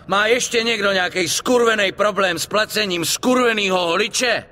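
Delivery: furious shout. Acted. Vito to the barbershop crowd, furious shout. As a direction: roar it at the whole room, daring anyone to answer. Threatening everyone after a fight.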